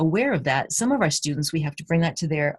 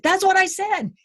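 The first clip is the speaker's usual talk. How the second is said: put-on much higher, indignant kid voice; child's insistent reply; 3 dB louder than usual. Student insisting to teacher, high indignant voice. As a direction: squeak it like an offended child. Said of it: "he replied".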